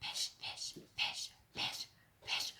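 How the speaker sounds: soft voiceless whisper. Acted. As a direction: breathe it into his ear. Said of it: "whispered in his ear".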